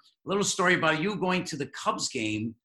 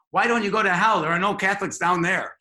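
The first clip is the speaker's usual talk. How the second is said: louder, higher, snapping nun's comeback; nun turning around to scold the hecklers, sharp; sharp retort; 5 semitones higher, 6 dB louder than usual. A narrator's reading. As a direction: snap it back like a stern nun. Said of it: "said sharply".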